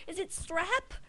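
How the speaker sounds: high-pitched mom impression; worried mother. Mother asking the doctor about strep, high imitation. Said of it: "And it's like".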